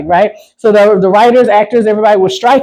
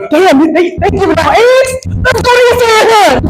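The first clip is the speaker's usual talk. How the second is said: high, hammed-up eager cry; mock excitement; 10 semitones higher than usual. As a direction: pitch it way up like a thrilled egg hunter. Acts out eager egg hunters.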